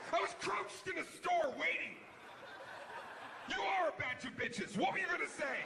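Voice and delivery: high, shouting rant at the texter; mock furious rant. Texter yelling at the friend who stopped typing, high furious shout. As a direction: snap and yell into the phone. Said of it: "snap and call the person".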